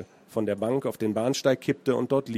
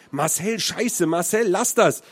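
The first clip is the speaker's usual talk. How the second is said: higher, louder panicked plea; alarmed scream; 6 semitones higher, 3 dB louder, slower than usual. A narrator's reading. Girl screaming at her friend to let go, alarmed. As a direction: scream at him to stop. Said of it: "schrie das zweite Mädchen".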